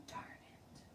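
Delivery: whispered, near tears; whispered little-girl letdown; very quiet. Acted. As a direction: breathe it out softly, disappointed. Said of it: "I said very quiet".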